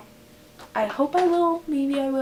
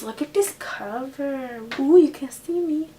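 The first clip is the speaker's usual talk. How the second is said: normal talking voice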